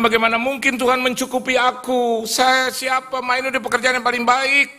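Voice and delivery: high, loud outburst; retold impassioned objection, sustained and loud; loud protest. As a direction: protest at full pitch in disbelief. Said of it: "Saya bilang".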